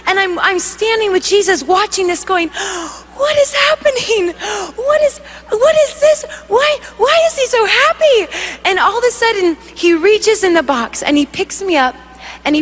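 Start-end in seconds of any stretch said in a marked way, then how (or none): 2.5-8.2 high, gasping questions in disbelief; bewildered onlooker, pitched way up; astonished. Acted. Watching with Jesus as he celebrates, astonished.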